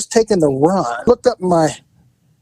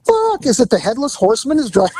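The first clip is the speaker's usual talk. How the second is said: shocked disbelief; 9 semitones higher than usual. Retold or imitated. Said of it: "I'm like".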